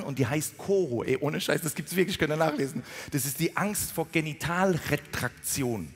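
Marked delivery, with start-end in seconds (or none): none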